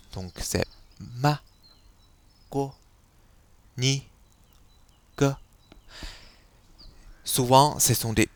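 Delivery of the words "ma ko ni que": «ma, ko, ni, que» au ton neutre, léger et lent; ton neutre chinois. Shown as a demonstration. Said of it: "Il faut le prononcer assez légèrement". (ton neutre, léger)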